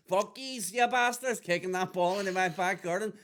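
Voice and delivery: hammed-up grumpy neighbour yelling over the fence; mock angry old neighbour. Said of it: "I would burst a ball".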